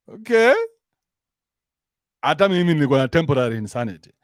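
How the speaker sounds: normal talking voice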